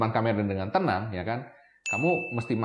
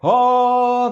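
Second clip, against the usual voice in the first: long, loud, high held "hooo"; belly-pushed "ho"; 12 semitones higher, 10 dB louder than usual. Shown as a demonstration. Showing a radio friend's breathing drill, belly-pushed loud "hooo". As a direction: push out a sustained "ho" from the belly. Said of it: "keluarin suara itu dari perut".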